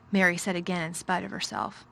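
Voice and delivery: usual speaking voice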